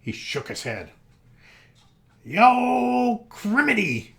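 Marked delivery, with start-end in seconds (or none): 2.2-4.1 high, loud frustrated outburst; sticky rabbit crying out in fury; furious cry. Acted. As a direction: shout it, stuck and fed up. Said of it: "he cried".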